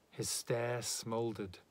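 ordinary delivery

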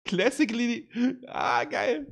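high, delighted reading out; thrilled squeal. Real, not acted.

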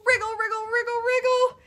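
high-pitched run of storybook wriggles; acted squirming in a sticky web; playful struggling. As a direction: wriggle the words out, held up high. Owl struggles stuck in web.

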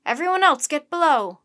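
loud, high captain's command; bellowed command; shouted order. A narrator's reading. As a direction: shout it out to the crew. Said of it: "the captain shouted to his crew".

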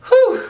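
sharp high exhale of nerves; nervous whew. Real, not acted. Student bracing to check grades, nervous high exhale. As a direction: blow it out before the bad news.

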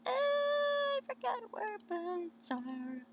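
slow, very high drawn-out voice; high singsong. Pretend. Player owning up to forgetting, high singsong. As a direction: stretch it out way up high, playfully.

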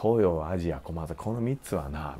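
low, quiet imitation of a senior, slowing down; 先輩の説教. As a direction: lay down the rules in a slow, low undertone. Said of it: "みたいなから始め". (先輩の説教を低く静かに真似)

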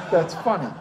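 loud burst of laughter on the words; laughing. Real, not acted. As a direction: laugh it out, really amused. Laughing at his own joke.

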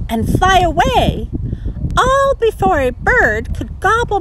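high, light fluttery creature voice; butterfly voice. Acted. Butterfly telling Sally Jane about her wings. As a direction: tell it like a delicate butterfly.

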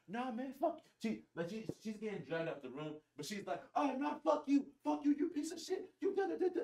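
high-pitched mock rant from an angry girl. Girl dragged out by friends cursing back, high mock voice. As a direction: ham up her high squeaky cursing.

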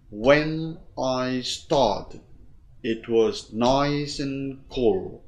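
slow beats on "when", "started", "nice", "cool"; four measured stresses, "nice" and "cool" lengthened; rhythm demo. Shown as a demonstration. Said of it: "Thì nhịp của nó là".

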